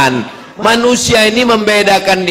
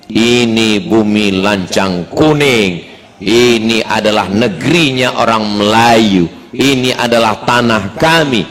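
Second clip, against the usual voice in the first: lightly voiced Malay local, low and measured; Malay local speaking; 7 semitones lower than usual. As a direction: state the claim slowly and low as a Malay host. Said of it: "Apa kata orang Melayu?".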